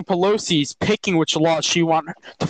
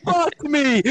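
higher, hammed-up dismay; mock panic; 8 semitones higher, much slower than usual. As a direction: play the caught husband. Mock panic after name reveal.

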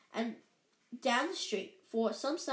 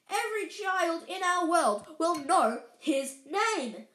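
louder, higher, rising conviction; heated professor, louder at the end; impassioned McGonagall; 4 semitones higher, 4 dB louder than usual. Acted. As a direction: build to a passionate prediction. McGonagall argues about Harry's fame.

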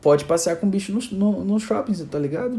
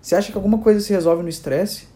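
raised, emphatic scolding for calm; urging calm; 5 semitones higher, 4 dB louder than usual. Acted. Owner answering the panicking mother, acted exasperation. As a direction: demand calm in a higher, forceful voice. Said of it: "Fala assim".

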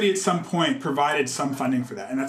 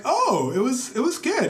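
lifted, higher voice for his own reply; replying lightly in a raised pitch; bright retort; 8 semitones higher than usual. Retold or imitated. Retelling his answer to director.

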